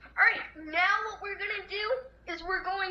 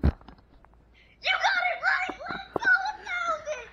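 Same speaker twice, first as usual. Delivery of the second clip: excited cheer; 12 semitones higher, slower than usual. Real, not acted. Cheering a right answer.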